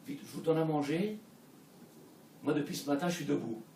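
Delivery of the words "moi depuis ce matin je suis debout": ordinary talk, nothing marked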